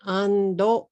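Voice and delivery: "ando" in two even beats, ending on an o; Japanese-style AND, an extra vowel after the d; katakana English. Shown as a demonstration. Teacher counting syllables for learners, katakana English. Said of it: "say these words in katakana English".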